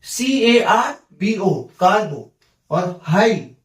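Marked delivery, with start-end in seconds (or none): 0.0-2.3 सीएआरबीओ spelled slowly, then कार्बो said whole; slow spelling then blended कार्बो; syllable demo. Shown as a demonstration. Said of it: "तो देखिए".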